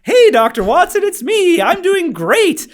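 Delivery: very high, loud sing-song imitation, last words drawn out slowly; imitating the patient. Patient's video message to grumpy Doctor Watson, high and chirpy. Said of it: "video of her being like".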